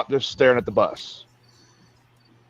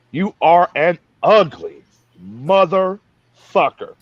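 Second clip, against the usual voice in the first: slow, drawn-out sergeant taunt; lightly voiced drill sergeant sneering; drill sergeant insult; 3 semitones higher, 3 dB louder, slower than usual. Drill sergeant insulting a recruit in a slow, mild mock voice. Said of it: "looks at me and goes".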